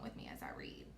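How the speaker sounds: fast hushed whisper. Real, not acted. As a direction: whisper it quickly. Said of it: "so just listen".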